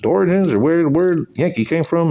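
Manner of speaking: mock quizzing teacher, pitched high up; quoting the teacher. Sixth-grade biology teacher quizzing the class, mock high teacher voice. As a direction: put on the teacher asking the class. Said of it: "my biology teacher was like".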